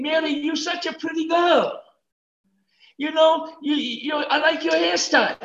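high, gushing mock compliment; playful high-voiced sweet talk; mock flattery. Mocks flattery Gabriel did not give.

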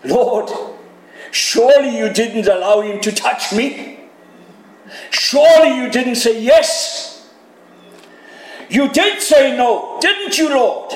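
anxious plea building to a cry; pleading protest. Retold or imitated. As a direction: beg the Lord it isn't so. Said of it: "I know what I would have said".

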